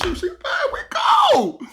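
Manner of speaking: high gleeful cheer. Retold or imitated. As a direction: squeak it out with glee. Happy again.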